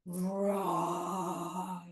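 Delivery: low, long roar; drawn-out bear rumble; forest bear roar. Pretend. Big forest bear roars for the children, long roar. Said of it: "Big forest bear".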